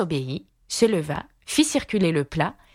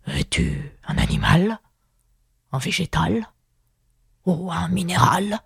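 weary lion; 2 semitones higher than usual. A narrator's reading. Weary lion questioning Alice.

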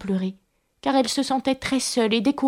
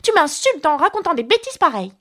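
high-pitched, affronted mouse; offended retort; 8 semitones higher than usual. A narrator's reading. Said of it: "rétorqua la souris". (ton vexé, indigné)

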